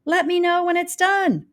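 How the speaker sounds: put-on tossing it off lightly to a child; breezy neighbor. A narrator's reading. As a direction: careless grown-up voice. Neighbors' quote, voiced.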